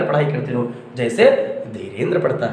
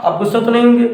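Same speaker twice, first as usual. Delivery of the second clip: louder, higher playful question; teasing worry; 8 semitones higher, 6 dB louder, faster than usual. Real, not acted. Teacher teasing the class. (ऊँची, चिढ़ाती आवाज़ में)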